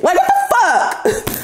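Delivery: high, slow disbelieving squeal; exasperated. Real, not acted. Reading tarot cards, exasperated high squeal of disbelief. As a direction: throw up your hands at the nonsense.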